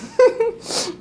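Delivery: a quick high laugh; short laugh. Real, not acted. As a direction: laugh it off at yourself. Laughs at own blunder.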